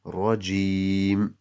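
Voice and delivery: Rajim closed on m with no vowel after; long "ji", then rest on the final m of Rajim; stopped ending. Shown as a demonstration. Teacher repeating the word as stopped, stopped ending. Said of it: "we say Rajim".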